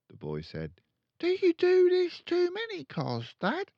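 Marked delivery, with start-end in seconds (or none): 1.2-3.7 put-on much higher, eager child; a curious little boy asking; young boy voice. Son asking his dad, high and eager.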